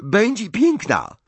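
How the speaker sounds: put-on a young French bride, wounded and defiant; Fleur, French accent. A narrator's reading. Fleur answers Mrs Weasley with a high French accent.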